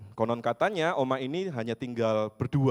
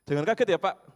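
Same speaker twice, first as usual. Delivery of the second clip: raised, higher urgent warning; mock urgent caution; 7 semitones higher, 6 dB louder than usual. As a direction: warn him sharply not to be shocked. Retelling daughter-in-law's warning.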